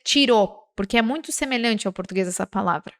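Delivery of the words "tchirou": "tirou" pronounced with ch at the start, chee-ROH; Portuguese-style T. Shown as a demonstration. Teacher showing the Portuguese tendency, "tirou" starting with a ch sound. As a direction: turn the T of "tirou" into ch. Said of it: "tendência do português de dizer".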